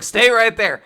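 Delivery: mock command. Fuko ordering Nico.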